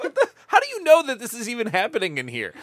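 laughing, exasperated question; retold disbelief. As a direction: act out baffled indignation, half laughing.

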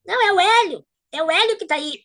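high called-out neighbor's voice; neighbor calling out. Retold or imitated. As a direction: call out high to reassure. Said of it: "E aí ela disse assim".

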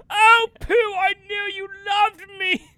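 high, loud theatrical devotion; joyous hammy actor. Acted. Actor spared by the bear, melodramatic relief. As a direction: gush grandly to a beloved friend. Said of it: "he'll be like".

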